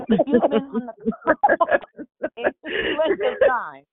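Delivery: high, loud talk through real laughter; cracking up while trying to carry on; laughing. Real, not acted. Host resuming the point on the call, laughing hard.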